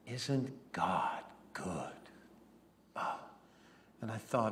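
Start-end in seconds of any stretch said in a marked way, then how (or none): 0.1-2.0 slow, hushed whisper of wonder; awed whisper. Acted. Sarah marvelling at the sunset, whispered slowly. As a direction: breathe it out in quiet awe.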